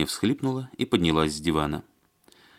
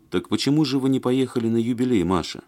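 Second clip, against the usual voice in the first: ordinary delivery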